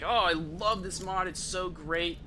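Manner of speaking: ordinary talk, nothing marked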